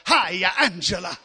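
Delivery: lightly voiced preacher greeting his wife; mock preacher to wife. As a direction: lay on the preacher voice at home. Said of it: "he says to his".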